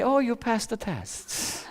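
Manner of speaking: soft, slow, teasing verdict; mock sweet praise. Girl teasing her calm boyfriend, soft and slow. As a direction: let him down gently with a smirk.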